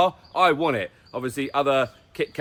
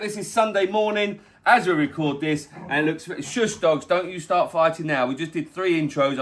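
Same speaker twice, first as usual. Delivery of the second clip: ordinary talk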